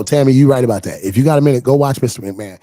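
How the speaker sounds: loud, insistent recommendation; loud urging. Real, not acted. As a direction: call it out to the audience. Urging viewers loudly.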